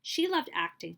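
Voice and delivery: plain reading voice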